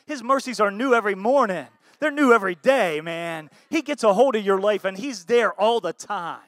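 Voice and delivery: loud, rising preacher's proclamation; preaching at full force. Real, not acted. Pastor to his flock, loud and building. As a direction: drive it home to the congregation.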